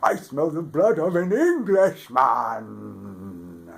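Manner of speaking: loud, high booming giant; giant's roar. A narrator's reading. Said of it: "the giant roared".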